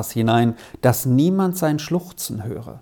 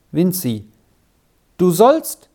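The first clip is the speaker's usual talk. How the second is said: light call, rising and breaking off; 3 semitones higher, 3 dB louder than usual. A narrator's reading. Child running up to fetch the brother, calling out. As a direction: call his name, then lift the voice. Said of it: "rief jetzt eine Stimme".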